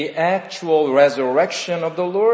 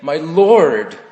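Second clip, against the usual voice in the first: cried out; 6 dB louder than usual. Retold or imitated. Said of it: "He cried out to Jesus".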